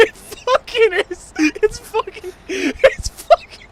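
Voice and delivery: high, loud, helpless laughter; squealing through real laughter; laughing hard. Real, not acted. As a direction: crack up while trying to speak. Cracking up at the impression.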